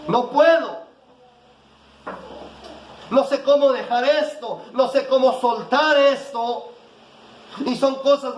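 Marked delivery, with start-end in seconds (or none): 0.1-6.7 despairing believer. Retold or imitated. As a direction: voice the listener's despair, pleading. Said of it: "tú dices".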